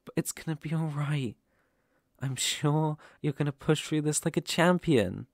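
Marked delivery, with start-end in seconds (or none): none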